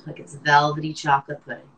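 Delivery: ordinary talk, nothing marked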